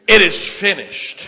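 loud, high, slow proclamation; triumphant cry from the cross. Acted. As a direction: cry it out as the final word. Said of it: "last thing Jesus said while he was on the cross".

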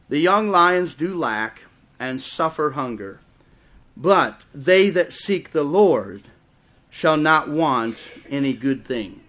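slow, emphatic verse reading; scripture reading. Real, not acted. Preacher reading a psalm to the congregation, slow and emphatic. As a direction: read the verse out with deliberate weight. Reads the Bible verse aloud.

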